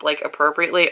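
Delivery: usual speaking voice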